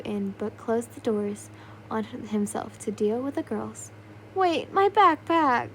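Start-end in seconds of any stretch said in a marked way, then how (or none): 4.3-5.7 loud and slow calling out. Acted. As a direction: cry out after the closed door. Doors slam, backpack left.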